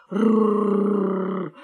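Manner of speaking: long, low rolled growl; menacing rrrr; dog growling. A narrator's reading. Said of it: "зарычал пёс".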